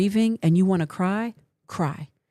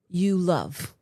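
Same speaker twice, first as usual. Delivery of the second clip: usual speaking voice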